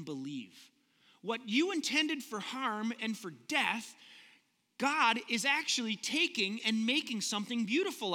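slow, weighty sermon line; slow emphatic preaching. Acted. Preacher to congregation, slow and weighty. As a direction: lean on every word, slowly.

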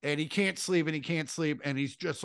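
ordinary delivery

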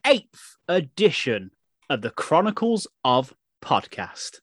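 slow, drawn-out announcer delivery; big theatrical welcome; show host intro. Acted. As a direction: stretch the key words like a grand podcast opener. Performs the show's intro.

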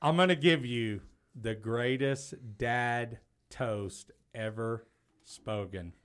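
normal talking voice

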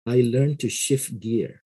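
ordinary talk

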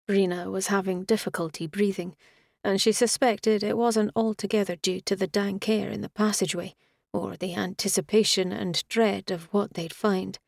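plain reading voice

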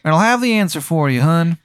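high, slow Baltimore hon voice; Maryland accent. Acted. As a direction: stretch out "one hour" in a high Maryland twang. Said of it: "And he goes".

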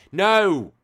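high, loud cry of protest; shout. Real, not acted. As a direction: yell no in dismay. Dreading the next rude word.

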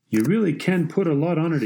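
understated narrated yell; mild yell. A narrator's reading. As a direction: call encouragement to your wife across the court. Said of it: "he yelled".

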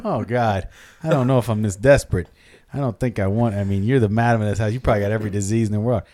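low, fast, uneasy refusal; reluctant customer. Acted. Customer refusing the old madam's offer, reluctant. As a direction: back out with mild disgust.

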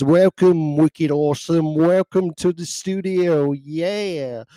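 high, loud British DJ greeting; British accent. Retold or imitated. DJ welcomes listeners to the studio, imitated British accent. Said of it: "In her British accent".

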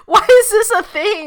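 exasperated. Real, not acted. Reacting to cannibal veganism.

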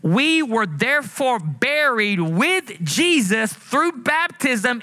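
slow, high, drawn-out scripture reading; preached scripture reading. Acted. Preacher reading Romans to his congregation, slow and lifted. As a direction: lift each phrase of the verse slowly to the church. Reads scripture slowly, rising.

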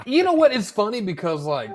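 loud, high burst; excited. Real, not acted. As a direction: jump in eagerly. Excited jump in.

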